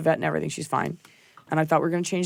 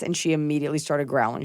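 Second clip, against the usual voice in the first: ordinary talk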